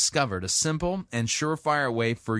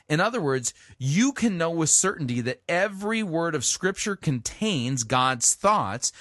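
usual speaking voice

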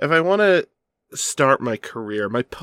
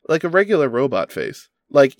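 ordinary talk, nothing marked

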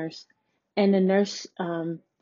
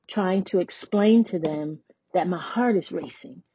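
ordinary talk, nothing marked

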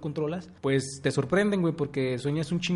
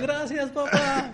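high, laughing mock thanks; 11 semitones higher, much slower than usual. Friend mock-thanking his dad for the foul, laughing high. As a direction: squeak it out as a joke.

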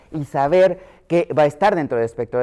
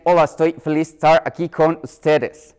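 lightly imitated foreign accent speaking Spanish; a hint of a non-native Spanish accent. Pediatrician imitating a foreigner who learned Spanish late, light foreign accent. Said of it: "no escuchó el acento español".

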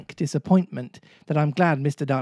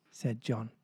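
usual speaking voice

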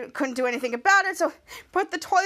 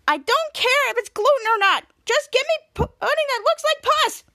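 higher, louder bratty demand; Piper's demand; 10 semitones higher, 4 dB louder than usual. Retold or imitated. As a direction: Piper insisting on her gross pudding, higher and louder. Piper demanding pus pudding.

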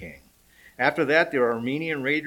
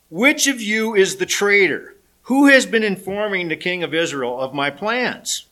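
angry king; 6 semitones higher, 6 dB louder than usual. Acted. Angry king questions officers.

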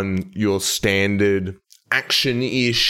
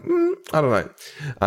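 unimpressed doubt; 7 semitones higher, faster than usual. Retold or imitated. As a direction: imitate a listener who isn't convinced, pitch jumping up on "Mm". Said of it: "I would also be like".

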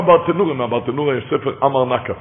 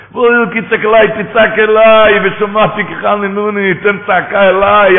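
high, loud, slowing call to cry out; loud divine plea; 5 semitones higher, 7 dB louder than usual. Acted. Preacher voicing God urging the borrower to cry out. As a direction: urge them to cry to God.

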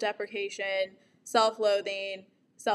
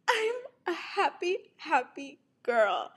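chirpy sing-song; 6 semitones higher than usual. Retold or imitated.

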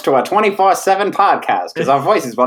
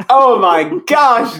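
surprised gasp; 9 semitones higher, 5 dB louder, much slower than usual. Pretend. Fan meeting famous guest.

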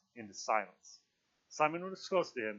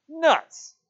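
high, very loud one-word yell; shocked outburst; 15 dB louder than usual. A narrator's reading. Piggy, shocked out of decorum by Simon, bursts out loudly. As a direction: explode with indignant disbelief. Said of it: "shocked out of decorum".

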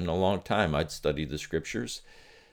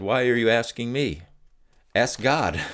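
a light impression of the wife, raised and brisk; wife's retort; 5 semitones higher, 4 dB louder than usual. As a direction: toss the question back at him as she did. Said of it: "she looked at me and she said".

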